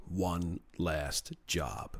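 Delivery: low, hushed and very slow; drawn-out trailer tagline; movie-trailer voice. Acted. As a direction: intone it like a heist film title, dropping low. Heist movie title, trailer style.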